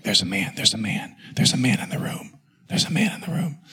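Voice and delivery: imitating wife's bedside whisper; hushed, breath-only repeated warning; urgent whisper. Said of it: "she whispers at me".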